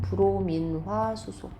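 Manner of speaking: slow, stretched 브로민화 수소; reading demonstration. Shown as a demonstration. Teacher modelling the next compound name, slow demonstration. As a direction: spell out 브로민화 수소 by ear, syllable by syllable. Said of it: "이렇게 읽어야죠".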